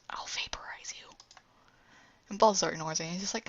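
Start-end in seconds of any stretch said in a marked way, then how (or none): 0.1-1.1 soft whispered threat; offended alien whispering a threat; menacing whisper. Acted. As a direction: hiss the threat under your breath. Gets really offended.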